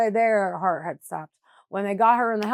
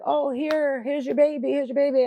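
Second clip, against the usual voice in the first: higher-pitched quote of busy staff; imitating hospital staff; 6 semitones higher than usual. Hospital staff handing mother her baby, lightly imitated.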